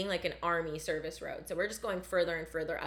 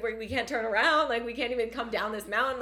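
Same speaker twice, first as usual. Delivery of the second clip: louder, higher panicked plea, half-performed; imitating her sobbing panic; 4 semitones higher, 5 dB louder than usual. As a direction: replay the tearful "I don't wanna die" moment. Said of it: "like, sobbing".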